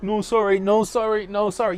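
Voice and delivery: high, loud, repetitive brush-off; mock repeated refusal. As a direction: fend off touts on repeat.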